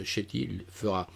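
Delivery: usual speaking voice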